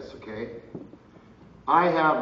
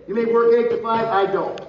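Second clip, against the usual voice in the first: louder, higher telling-off; raised-voice exasperation; indignant complaint; 7 semitones higher, 7 dB louder than usual. Retold or imitated. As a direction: tell off an office worker, retold. Retold complaint to the feds.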